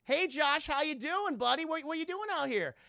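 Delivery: high, loud fake-cheery cop voice; mock friendly sheriff. Sheriff greeting Josh on the curb, fake cheery. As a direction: greet him with hammed-up friendliness. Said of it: "He goes".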